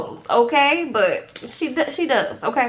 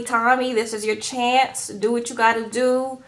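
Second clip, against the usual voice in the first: usual speaking voice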